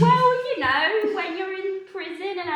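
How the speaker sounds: breezy, bright, loud excuse; tipsy excuse. Acted. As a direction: wave it off grandly. Drunk excuse, voice jumps.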